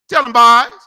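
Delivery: loud rallying shout; calling out. Real, not acted. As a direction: shout it out to your crew. Calling out to his men.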